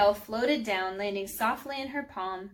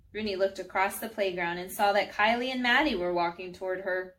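usual speaking voice

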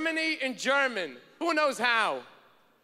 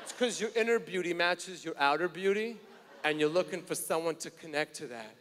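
smooth flattery; 8 semitones lower, 5 dB quieter than usual. Retold or imitated. Fake astrology flattery.

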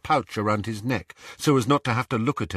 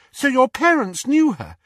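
interested; 6 dB louder than usual. Acted. Hermione interested.

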